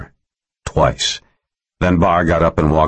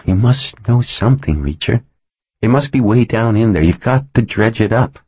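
higher, insistent female character; urgent plea in a raised-pitch voice; urging woman lawyer; 7 semitones higher than usual. A narrator's reading. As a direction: press him to remember, pitched up. Voices Helen pleading with Reacher.

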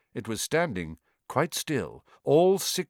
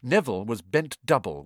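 plain reading voice

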